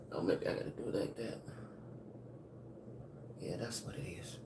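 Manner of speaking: whispered under her breath to herself; barely voiced, talking to herself while fixing the stream; muttered aside. Real, not acted.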